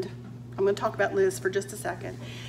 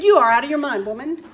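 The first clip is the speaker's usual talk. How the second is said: a mock exclamation, loud at first; playful outburst; 4 semitones higher, 9 dB louder, faster than usual. Telling her friend Liz that a YouTube channel is crazy, a mock loud outburst. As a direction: tease a friend with a burst of disbelief. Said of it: "I said".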